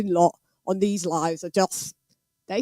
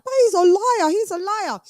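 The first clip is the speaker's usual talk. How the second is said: higher, whiny mock of the doubters; mocking critics; 10 semitones higher than usual. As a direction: parody the naysayers in a high sneer.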